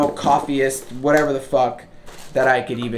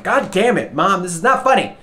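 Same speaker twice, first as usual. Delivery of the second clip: loud, higher cry of frustration; exasperated shout; 7 semitones higher, 6 dB louder than usual. Retold or imitated. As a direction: yell it at mom, fed up. Yelling at mom on phone.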